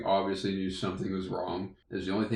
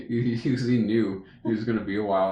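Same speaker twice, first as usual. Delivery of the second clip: ordinary delivery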